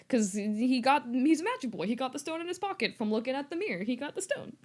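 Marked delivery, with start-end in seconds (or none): none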